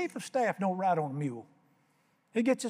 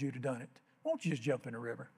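much softer, coaxing servant; gentle coaxing; 5 semitones lower, 8 dB quieter, faster than usual. Retold or imitated. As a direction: ease him toward yes. Servant gently persuades his master.

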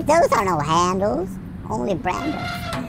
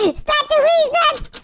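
squeaky voice. Pretend. Squeaky question, silly voice.

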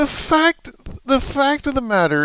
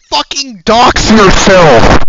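a slow, enormously louder scream; full-throated scream; 14 dB louder, slower than usual. Real, not acted. Raging at YouTube's demand to reveal personal info, screaming. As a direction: scream the words in outrage. Ranting about YouTube's policy.

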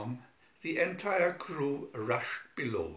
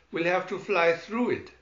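loud determined; 5 semitones higher, 7 dB louder than usual. Acted. Pilot over emergency.

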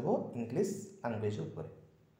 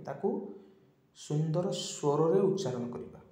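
ordinary talk

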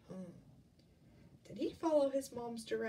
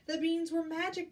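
raised, louder storybook exclamation; excited cry; 6 semitones higher, 5 dB louder than usual. A narrator's reading. Jack discovers the beanstalk, excited cry. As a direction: cry it out higher, as an amazed boy. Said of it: "Jack cried".

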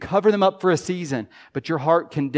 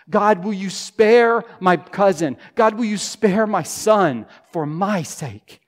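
rising, louder pleading for family; fervent prayer; 4 semitones higher, 6 dB louder than usual. Pretend. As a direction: build the plea, pressing harder each time. Voices an intercessory prayer.

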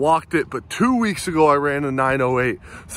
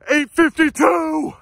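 high, slow, elated; thrilled; 12 semitones higher, 3 dB louder, slower than usual. Real, not acted. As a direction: savor each number with joy. First announcing time, elated.

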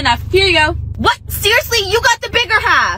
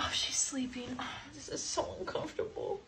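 breathy whisper so she stays asleep; hushed, unvoiced words; 5 semitones lower, 23 dB quieter than usual. Sneaking while sister sleeps.

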